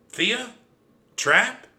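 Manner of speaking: high, loud questioning calls; shouting. A narrator's reading. Mouse spots sister and cousin in the distance, shouting. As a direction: call out to someone far off. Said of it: "I shouted at the top of my lungs".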